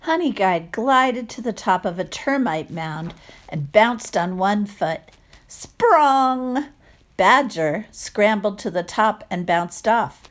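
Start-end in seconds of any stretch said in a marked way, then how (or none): 5.5-6.7 bouncy sound effect. Acted. Sound effect read aloud.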